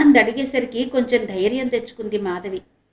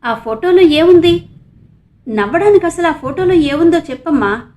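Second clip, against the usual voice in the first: urgent demand; 7 semitones higher, 10 dB louder than usual. A narrator's reading. Brother demands answer.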